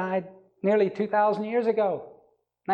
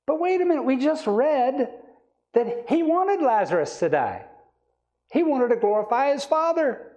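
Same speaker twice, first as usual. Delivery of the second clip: emphatic objection; 8 semitones higher than usual. Real, not acted. Preacher challenges what he grew up believing, high emphatic objection. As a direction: pitch jumps high in protest.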